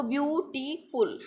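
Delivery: ब्यूटीफुल split as ब्यू-टी-फुल; in three parts. Shown as a demonstration. Teacher repeats the syllable count, ब्यूटीफुल broken into ब्यू-टी-फुल. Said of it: "three parts में हमें बोलना पड़ा".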